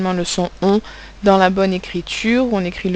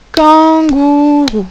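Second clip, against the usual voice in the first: kangourou drawn out slowly, higher and louder; syllable by syllable; 6 semitones higher, 6 dB louder, much slower than usual. Shown as a demonstration. Teacher dictating to pupils who count syllables, slow and drawn out. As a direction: stretch each syllable of kangourou for the class. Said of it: "Trois syllabes".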